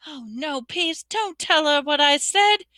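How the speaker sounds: high-pitched anxious pleading; frantic. A narrator's reading. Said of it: "Hermione whispered frantically".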